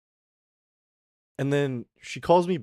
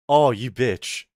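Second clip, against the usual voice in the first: louder, retold 'oh you bitch' reaction; mock exclamation; 8 dB louder, slower than usual. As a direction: throw it out as a half-joking outburst. Recognizing the woman, retold reaction.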